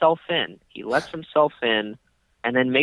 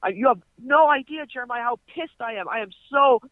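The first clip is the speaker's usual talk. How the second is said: very high, strained mock fury; mock furious ranting; 12 semitones higher than usual. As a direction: squeal how pissed he is. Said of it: "And he's like".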